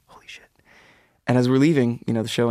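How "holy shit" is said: breathless unvoiced gasp of disbelief; awed whisper. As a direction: mouth it under your breath. Retold awe at spotting [name].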